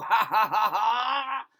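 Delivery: wicked throaty cackle; Grinch cackle. A narrator's reading. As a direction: cackle like a villain with a plan. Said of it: "The Grinch laughed in his throat".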